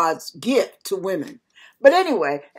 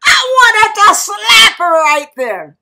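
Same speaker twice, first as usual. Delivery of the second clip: high, loud cry of frustration; exasperated outburst; 9 semitones higher, 6 dB louder than usual. Real, not acted. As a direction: burst out at the end of your patience. Exasperated at the crying woman.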